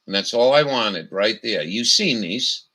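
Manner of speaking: usual speaking voice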